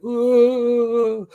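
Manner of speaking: a high, far-off "Ooh" of timid alarm; mock scared ooh. Reviewer imitates London detectives cowering at a Belfast accent, high mock-alarmed ooh. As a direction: play Londoners spooked by the accent. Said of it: "and they're like".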